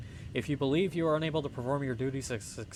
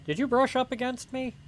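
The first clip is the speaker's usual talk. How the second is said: high offended voice; 10 semitones higher than usual. Acted. Reacting to in-game character.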